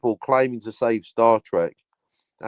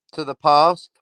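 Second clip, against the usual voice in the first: ordinary talk, nothing marked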